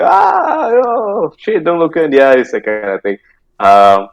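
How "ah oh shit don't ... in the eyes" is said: alarmed cry. Retold or imitated. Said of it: "Then everybody's like".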